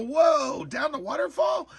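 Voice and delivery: high, loud astonished cry; stunned outburst; amazed shout. Retold or imitated. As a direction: shout it as the lights drop over the falls. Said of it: "and I go".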